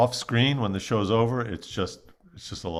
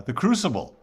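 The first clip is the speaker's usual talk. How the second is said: ordinary talk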